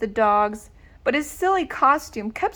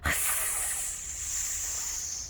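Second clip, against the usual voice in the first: frightened gasp; 13 dB quieter than usual. Acted. Horrible hissing sound.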